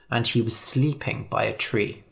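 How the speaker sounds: ordinary delivery, nothing marked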